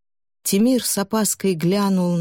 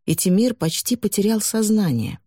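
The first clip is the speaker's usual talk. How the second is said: usual speaking voice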